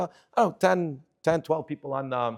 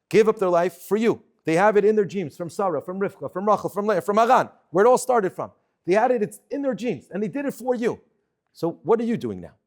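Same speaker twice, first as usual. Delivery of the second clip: louder, rising preacher's fervor; preaching; 3 semitones higher, 4 dB louder than usual. Acted. Urging the shiur audience to honor the soldiers, fervent preaching. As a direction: drive the point home to the crowd.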